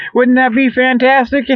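ordinary delivery

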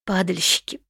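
restrained, voice close to breaking; barely holding it together; holding back tears. Acted. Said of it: "едва сдерживаясь".

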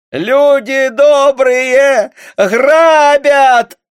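shrill high wail for help, very loud; wailing scream. A narrator's reading. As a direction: scream to the whole village that you're being robbed. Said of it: "заголосила на всю округу". (истошный вопль на всю округу)

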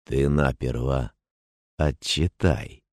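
soft, slow warning; quiet warning. A narrator's reading. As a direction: warn him quietly. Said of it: "прищурился Гнатий".

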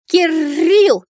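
put-on high, loud child voice with a rolled r; trilled, high childish cry; little dragon's shout. A narrator's reading. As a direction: call it out proudly like a baby dragon. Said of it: "донёсся с балкона голос дракоши".

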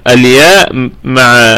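ordinary talk

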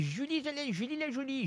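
tiny, high, soft put-on voice; Julie/character. Host plays his guest naming herself in the bar, high soft voice. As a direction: introduce yourself in a hushed high voice. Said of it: "Vous êtes Julie".

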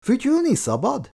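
cheeky high-pitched question; teasing. A narrator's reading. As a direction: needle the policeman with mock innocence. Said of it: "Kancler kötekedve visszaszólt".

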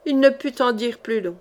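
ordinary delivery, nothing marked

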